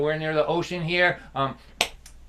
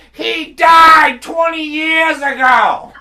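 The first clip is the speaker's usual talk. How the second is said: mock shout; 13 semitones higher, 14 dB louder, much slower than usual. Dad's punchline about dead doctor.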